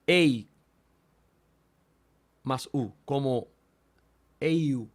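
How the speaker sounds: ei then eiu, blended into one glide; eta upsilon sound. Shown as a demonstration. Teacher demonstrating eta upsilon to students, slow, deliberate. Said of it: "suena como".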